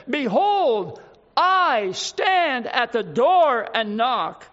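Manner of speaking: "I stand at the door and knock" drawn out slow, each word weighted; slow solemn weight on "door and knock"; slow emphatic quote. Shown as a demonstration. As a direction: lean on "door" and let "knock" land slowly. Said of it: "Who is this who stands".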